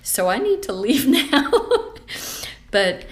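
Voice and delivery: high, loud, teary laugh; close to crying. Real, not acted. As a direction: laugh through welling tears, voice climbing. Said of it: "I'm gonna go cry".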